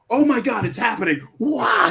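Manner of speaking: high, loud awestruck "oh my God"; awed gasp. Retold or imitated. Moviegoer to the screen, awestruck and loud.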